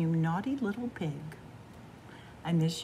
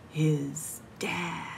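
breathy hushed whisper; whispered reveal; 3 semitones lower than usual. A narrator's reading. Narrator revealing the dad as villain in a whisper. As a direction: drop to a secret whisper.

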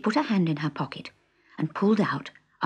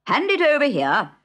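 Dodo voice; 10 semitones higher, 6 dB louder than usual. A narrator's reading. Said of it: "said the Dodo".

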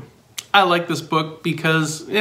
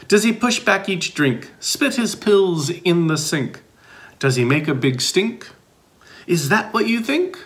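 put-on lively read-aloud for young listeners; bouncy picture-book reading; reading to children; 4 semitones higher than usual. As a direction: read it like story time for kids.

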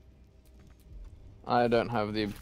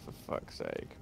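whispered curse; 10 dB quieter than usual. Real, not acted. Frustrated at game.

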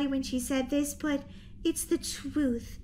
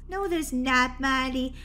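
ordinary delivery

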